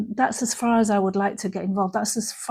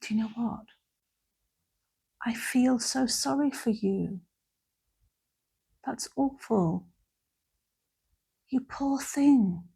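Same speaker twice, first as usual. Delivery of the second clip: pitying; 2 semitones higher, 4 dB quieter than usual. Shown as a demonstration. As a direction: talk down to them with pity, softly. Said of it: "So pity".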